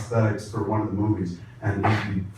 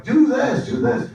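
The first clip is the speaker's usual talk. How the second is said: high, louder yelled direction; mock scream; 13 semitones higher, 4 dB louder, slower than usual. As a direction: imitate screaming instructions through makeup. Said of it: "I would have to scream through".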